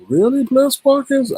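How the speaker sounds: high-pitched shocked "really"; shocked disbelief. Retold or imitated. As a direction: react like you can't believe it. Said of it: "I was like".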